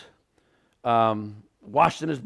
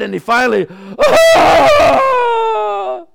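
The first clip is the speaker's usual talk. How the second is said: put-on high, loud wail of a sobbing boy; child's anguished cry; 11 dB louder, much slower than usual. Son cries out.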